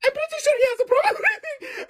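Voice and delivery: very high-pitched nurse impression; put-on high Russian nurse voice. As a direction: squeal it like a fussing nurse. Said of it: "I had a Russian nurse".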